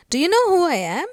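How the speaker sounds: loud indignant demand, pitched high; furious. Acted. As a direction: demand it like an outraged little girl. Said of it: "So looking furious".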